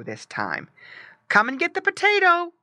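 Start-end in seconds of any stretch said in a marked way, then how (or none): 1.3-2.5 high, loud, quick call; boy's high-pitched coaxing shout. A narrator's reading. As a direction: call the pet over quickly. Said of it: "called the boy".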